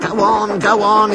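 high mock scream, not full force. A narrator's reading. Squeezed van urging the engines, mildly screaming. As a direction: cry it out high, as a restrained scream. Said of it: "it screamed".

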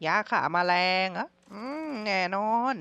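a lightly put-on voice echoing "ยาฆ่าแมลงเหรอ" as a question; receptionist voice. Hotel receptionist answering the guest, in a lightly changed voice. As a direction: lightly play the hotel clerk repeating the order.

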